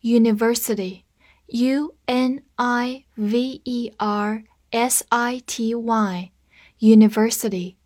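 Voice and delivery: slow, letter-by-letter spelling. Shown as a demonstration. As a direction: spell it out slowly for learners. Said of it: "U发字母本身的音". (慢速逐个字母拼读)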